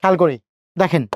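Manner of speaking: ordinary talk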